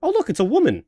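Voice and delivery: high, chirpy unfazed delight; mock-happy socially awkward guy; cheerfully oblivious Alan. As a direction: act thrilled by every disaster. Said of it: "I am now gonna also be Alan".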